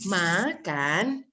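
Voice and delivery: MAKAN very slowly stretched with a swooping tune; odd, unnatural tune. Shown as a demonstration. Teacher imitating someone who sounds odd, strangely sung-out makan. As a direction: drag makan out strangely, rising at the end. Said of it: "merasa aneh aja kalau ada orang bilang".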